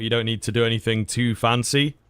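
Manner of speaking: normal talking voice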